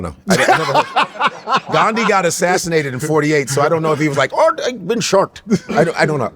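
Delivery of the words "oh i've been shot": mock Indian accent, higher; lightly put-on Indian accent, pitched up. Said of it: "that's what the accent would be".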